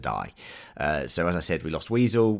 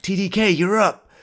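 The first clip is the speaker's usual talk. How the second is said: high, loud call-out to a teammate; Rick Flag; 13 semitones higher, 6 dB louder than usual. Retold or imitated. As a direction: imitate a squad leader shouting an order. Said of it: "Rick Flag's like".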